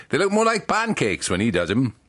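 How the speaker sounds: lightly done Welsh baker's wife; Welsh accent. Acted. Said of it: "says Missus Barnaby".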